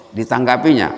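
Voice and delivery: normal talking voice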